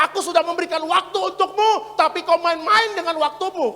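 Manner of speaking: high, loud preached rebuke; imitating God rebuking. God answering the left-behind, imitated in a loud rebuke. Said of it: "Lah, kata Tuhan".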